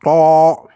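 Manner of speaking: heavy throaty "To"; "To", le T du fond de la gorge; emphatic T from the throat. Shown as a demonstration. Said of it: "le T du fond de la gorge".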